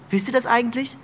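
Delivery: ordinary delivery, nothing marked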